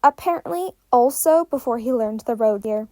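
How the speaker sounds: plain reading voice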